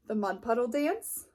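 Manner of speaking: ordinary delivery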